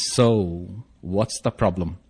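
low, quiet counselor voice. Retold or imitated. Counselor to the couple at intake, low and measured. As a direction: drop low and ask it softly. Voicing the counselor's opening question.

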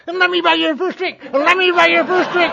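very high, mocking sing-song voice; teasing old-timer put on high; taunting Ralph. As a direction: needle the newcomer in a shrill jeering tone. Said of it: "He says".

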